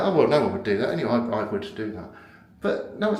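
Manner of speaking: ordinary talk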